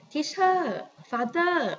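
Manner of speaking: "Teacher" and "father" with stretched, lifted last syllables; mock-classroom "Teacher", "father" with long endings; taught mispronunciation. Shown as a demonstration. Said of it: "we always teach that way".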